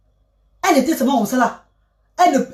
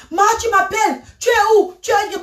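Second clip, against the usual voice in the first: calling out; 9 semitones higher than usual. Retold or imitated. Calling to mother in sky.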